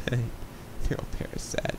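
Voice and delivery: "Neural parasat" breathed out in a slow, giggling whisper; laughing whisper. Shown as a demonstration. Caster mocking his own slip, whispered "Neural parasat" with a laugh. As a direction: repeat "Neural parasat" to himself under his breath, chuckling.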